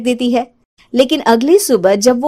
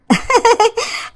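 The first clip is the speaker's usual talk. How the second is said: sly high giggle; 12 semitones higher than usual. Acted. Witch laughs.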